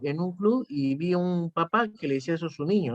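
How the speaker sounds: normal talking voice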